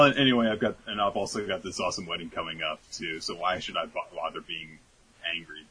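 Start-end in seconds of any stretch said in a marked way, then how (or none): none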